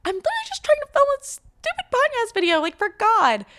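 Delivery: high-pitched mock exasperation; squeaky retold meltdown; upset. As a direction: whine it way up high, acting out frustration. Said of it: "I was just getting so upset".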